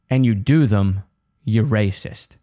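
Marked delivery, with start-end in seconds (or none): none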